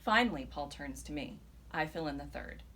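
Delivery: plain reading voice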